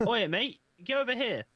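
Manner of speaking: high, loud attempt at an Aussie accent; attempted Australian accent. Shown as a demonstration. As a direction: call out in a half-convincing Australian accent. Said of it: "Give me your best Australian accent".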